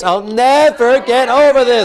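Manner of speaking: drawn-out, emphatic NEVER; modelled anguished anger. Shown as a demonstration. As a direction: hammer out the vow in anguish. Intensified take for client.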